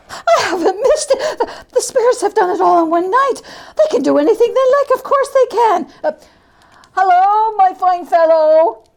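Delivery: high, fast, tumbling joy; giddy old man. Acted. Scrooge shouting down to the boy in giddy rapture. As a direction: rush it out in giddy delight.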